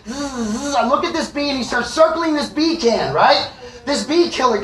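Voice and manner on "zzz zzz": short imitated bee buzzes; higher, soft vocal zzz; buzzing bee. Bee alone at five A M, imitated buzzing. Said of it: "this fucking bee just starts".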